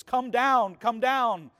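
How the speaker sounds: insist, calling him down; urgent plea. Retold or imitated. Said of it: "the man is telling Jesus".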